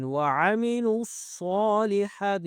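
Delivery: الصالحات with a thick, heavy saad; slow tajweed demonstration of وعملوا الصالحات; heavy saad recitation. Shown as a demonstration. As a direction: chant وعملوا الصالحات slowly, long "saa". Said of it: "صاد کو موٹا کرنا ہے".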